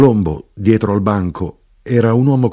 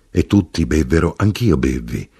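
usual speaking voice

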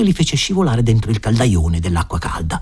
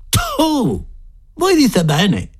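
high-pitched disgusted retort; spitting in disgust; much slower than usual. A narrator's reading. Scullery boy spits at the thought of the broth, disgusted. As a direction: spit it out, starting high. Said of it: "disse lo sguattero sputando".

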